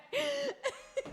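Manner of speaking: a high-pitched burst of laughter; real giggle fit. Real, not acted. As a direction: squeak out a laugh. Laughing at the clip.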